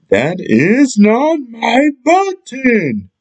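high, slow drawn-out wail. Acted. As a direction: stretch it out in a mock wail. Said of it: "wailed Toad".

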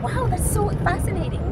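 high, gushing French-free fake wonder; mock fascination. Teacher mocking a gushy reaction, high and sing-song. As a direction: overdo the amazement. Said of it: "Not interesting, like".

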